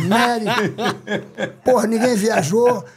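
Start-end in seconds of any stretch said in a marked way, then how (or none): 0.0-0.5 higher, laughing through the words. Real, not acted. Storyteller recalling the chaotic arrest, laughing. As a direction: laugh as you tell it.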